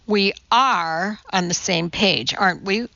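ordinary delivery, nothing marked

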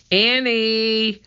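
high, sustained searching call; calling for someone out of sight. A narrator's reading. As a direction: call out the name, stretched long. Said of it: "called Jack".

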